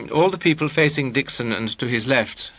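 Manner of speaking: plain reading voice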